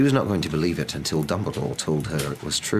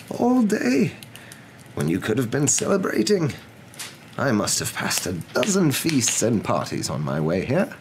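incredulous question; 8 semitones higher than usual. Acted. Acted incredulous wizard in story.

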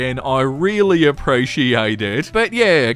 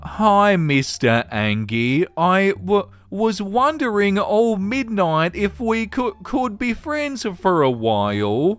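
timid, higher-pitched halting plea; shy stammer; 5 semitones higher than usual. Acted. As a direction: stumble shyly over the first words. Reads a shy role-play message.

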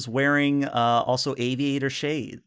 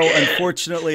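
ordinary talk, nothing marked

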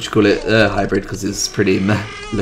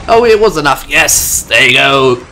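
loud, raised victory cheer; excited relief; 3 semitones higher, 6 dB louder than usual. Real, not acted. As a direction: burst into a delighted cheer. Attack unexpectedly wins the round.

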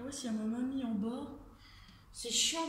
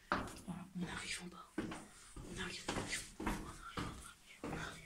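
whispering; 4 semitones lower, 12 dB quieter, slower than usual. Real, not acted.

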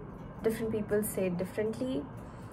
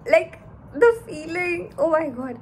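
excited; 7 semitones higher, 9 dB louder than usual. Real, not acted. Thrilled about first surgery.

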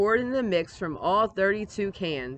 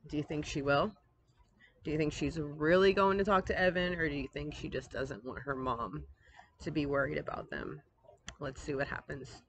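put-on softer, lower, confiding to the kids; aside to listeners; 3 semitones lower, 5 dB quieter than usual. A narrator's reading. Narrator asking young listeners a question, softly. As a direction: lean in and ask the children.

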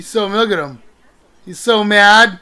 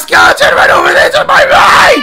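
high, loud frantic warning; terrified cry at a skeleton; panicked shout; 7 semitones higher, 7 dB louder than usual. Real, not acted. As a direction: shout it in fright. Spotting another skeleton.

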